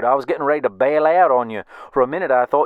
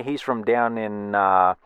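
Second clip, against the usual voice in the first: usual speaking voice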